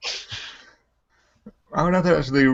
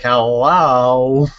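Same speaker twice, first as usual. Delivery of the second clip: slow, drawn-out sing-song hello; flamboyant hello; 5 dB louder than usual. Retold or imitated. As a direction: camp it up on the hello.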